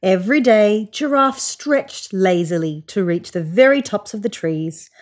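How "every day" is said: usual speaking voice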